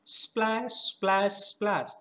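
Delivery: bright sound-effect splash, splash, splash; playful splash noises. Shown as a demonstration. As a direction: mimic water splashing. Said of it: "you will create a sound".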